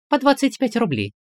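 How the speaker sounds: put-on very high shop girl's voice; obliging salesgirl. A narrator's reading. Young vendor quoting a price, high and obliging. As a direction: chime the price helpfully, very high. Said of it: "услужливо подсказала девушка".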